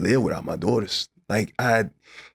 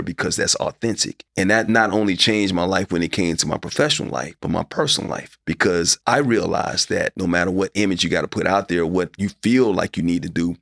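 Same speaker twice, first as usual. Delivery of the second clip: usual speaking voice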